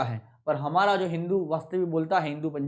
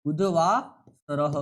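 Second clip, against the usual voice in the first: बुधवासरः drawn out very slowly; slow dictation; 3 semitones lower, much slower than usual. Shown as a demonstration. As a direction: spell बुधवासरः slowly for students. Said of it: "देख लीजिएगा".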